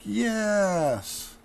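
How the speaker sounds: a little triumphant yes; happy yes. Acted. Teacher acting a happy pose for the class, high delighted yes. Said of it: "with happy, we're like".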